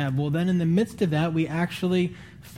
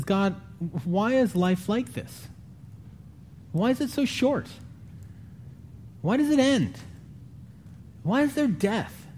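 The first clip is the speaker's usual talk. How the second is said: much higher, crying out to God; a desperate plea that rises and breaks; despairing sinner; 9 semitones higher than usual. Retold or imitated. Sinner crying out to God about death, anguished. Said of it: "Sinner says".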